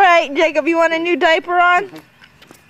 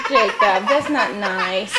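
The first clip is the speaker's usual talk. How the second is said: gentle scolding; 6 semitones lower, 4 dB quieter, slower than usual. Real, not acted. Scolding toddler for pushing.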